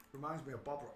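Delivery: ordinary talk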